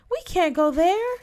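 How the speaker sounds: high-pitched, aghast refusal; jumping up, appalled; horrified protest. Acted. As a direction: recoil at the very idea. I shot to my feet.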